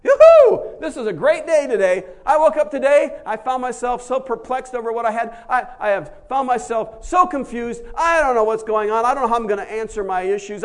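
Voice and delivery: very high, loud yoo-hoo and laughing praise; over-the-top jubilant shouting; ecstatic Paul. Acted. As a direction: whoop with wild joy. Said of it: "that's what he says".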